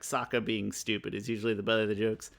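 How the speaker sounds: ordinary talk, nothing marked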